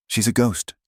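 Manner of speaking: ordinary delivery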